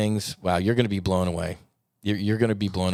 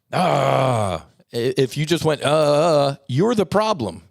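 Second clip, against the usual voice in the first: impassioned outburst; 6 semitones higher, 6 dB louder than usual. Real, not acted. Heated plea about enemies.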